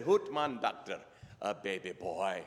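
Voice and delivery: subdued Scottish father, dropping low; deflated Scottish reply; Scottish brogue. Acted. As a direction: echo the news underwhelmed, in a put-on Scottish brogue. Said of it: "best Scottish brogue I can come up with".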